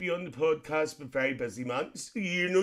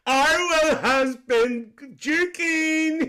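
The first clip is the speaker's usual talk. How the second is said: laughing read; 11 semitones higher, 5 dB louder, much slower than usual. Real, not acted. Reading comment while laughing.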